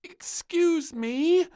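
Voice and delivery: put-on squeaky high little-girl voice, slow; a tiny princess piping up politely; high drawn-out piping voice; piped up. A narrator's reading. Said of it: "piped up the little princess".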